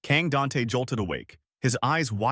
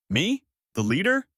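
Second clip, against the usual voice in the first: high, incredulous questions; disbelieving; 7 semitones higher, 3 dB louder than usual. A narrator's reading. Kang Dante asked to lead, disbelieving. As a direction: ask it high, unable to believe it. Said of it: "asked with a trembling, disbelieving voice".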